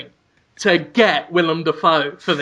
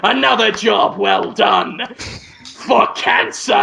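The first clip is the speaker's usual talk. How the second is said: slow, projected triumphant catchphrase; Willem Dafoe; slower than usual. Acted. Actor dusts off his hands after the cure, Willem Dafoe impression. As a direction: draw it out grandly, pausing before the last words. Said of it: "and then Willem Dafoe".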